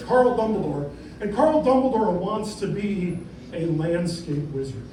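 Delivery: ordinary delivery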